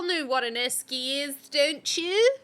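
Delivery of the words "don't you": ordinary talk, nothing marked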